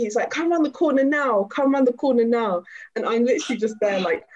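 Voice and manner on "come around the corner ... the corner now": loud, raised-pitch demand to fight; insistent retold challenge; mock threat. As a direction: imitate an aggressive man calling someone out. Acting man demanding a fight.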